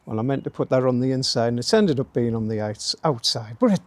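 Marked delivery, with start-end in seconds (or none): none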